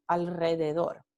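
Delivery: alrededor with the r rolled after the l; trilled r. Shown as a demonstration. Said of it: "es la vibrante múltiple".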